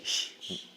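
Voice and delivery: short unvoiced hushing "sh"; voiceless sh demonstration. Shown as a demonstration. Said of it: "Hay que hacer sh". (sonido sh sordo y suave)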